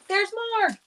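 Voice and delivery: high, strained voice cracking up; straining with effort. Real, not acted. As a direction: push through a struggling voice. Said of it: "my voice is still struggling tonight".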